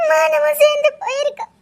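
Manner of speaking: breaking into sobs, voice climbing high on the last words; tearful, sobbing protest; crying. Acted. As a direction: plead through tears. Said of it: "அழாத சரியா?".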